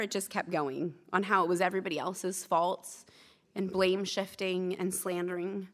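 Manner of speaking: usual speaking voice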